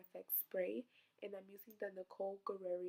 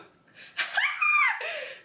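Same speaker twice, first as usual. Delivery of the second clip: high burst of laughter; 14 dB louder than usual. Real, not acted. Breaks character laughing.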